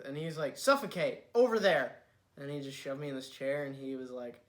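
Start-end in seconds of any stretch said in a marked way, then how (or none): 0.6-2.0 loud, raised comic bossing; mock angry order. Friend refuses latecomer a breather, mock angry order. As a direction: order them about with mock fury. Retells Gage ordering him around.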